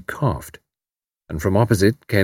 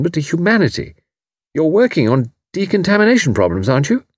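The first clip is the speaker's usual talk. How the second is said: higher, brisk and kindly; brisk encouraging Tuppence; 6 semitones higher than usual. A narrator's reading. Tuppence rallying despairing refugee, brisk. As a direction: buck him up.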